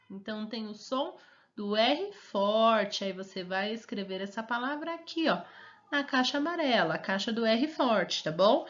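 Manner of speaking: usual speaking voice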